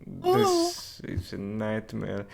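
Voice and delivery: slow, dragged-out daze; slow dazed murmur. Acted. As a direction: draw it out, stunned. Character dazed after waking.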